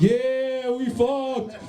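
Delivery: high, drawn-out triumphant crowing; hammed-up bragging. Drunk guy telling the boys about a hookup, high mock-triumphant brag. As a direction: crow it to your buddies.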